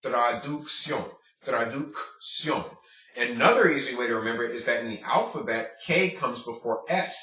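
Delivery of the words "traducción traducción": traducción said slowly, K then S; slow model word. Shown as a demonstration. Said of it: "hard stuff out of the way first".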